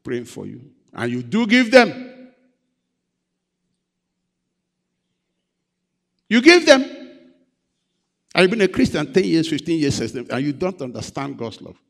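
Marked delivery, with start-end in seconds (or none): 0.9-6.8 much higher and louder, incredulous; preacher shocked that people pay pastors, exclaiming; incredulous exclamation. Acted. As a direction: cry it out as if you can't believe it.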